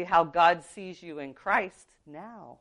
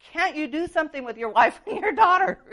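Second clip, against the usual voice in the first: exasperated; 10 semitones higher, 5 dB louder than usual. Retold or imitated. Inner plea to brother, exasperated.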